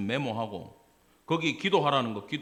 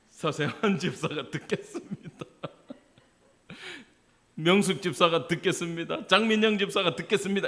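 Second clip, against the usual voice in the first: playful name-in prayer demo; 3 semitones higher than usual. Shown as a demonstration. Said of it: "이름 넣어".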